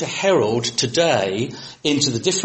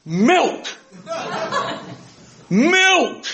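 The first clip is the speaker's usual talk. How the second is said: Milk! shouted higher and louder, laughing; louder, aggressive. Retold or imitated. British tourist shouting Milk! at a French waiter, aggressively loud. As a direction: bellow Milk! at a baffled waiter. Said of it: "speak louder and more aggressively".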